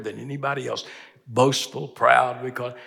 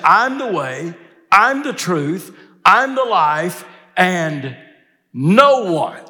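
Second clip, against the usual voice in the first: raised, loud stress on "no one"; emphatic; 6 semitones higher, 6 dB louder, much slower than usual. Retold or imitated. Preacher quoting Jesus's exclusive claim, emphatic. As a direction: drive each phrase high and hard, landing on "no one". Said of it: "that's pretty emphatic".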